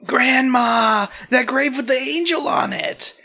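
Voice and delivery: high-pitched excited shout; high, hammed-up child's shout. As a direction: call out to Grandma from afar. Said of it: "You run back into the house shouting".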